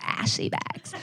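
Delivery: hushed mimicking of a name; teasing near-whispered repeat; imitating someone's delivery. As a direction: echo it back in a soft, voiceless tone. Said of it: "I love the way you said that".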